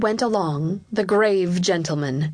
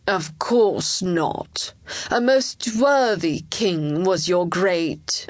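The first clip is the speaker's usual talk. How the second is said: very slow, drawn-out official's voice; solemn courtier; slower than usual. Acted. Chancellor assuring the young king, slow and solemn. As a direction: deny it ponderously, stretching the words.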